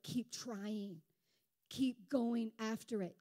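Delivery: usual speaking voice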